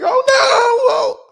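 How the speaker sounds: very high, loud crying shout; mock cry shout. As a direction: howl it in mock despair. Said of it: "And he's like".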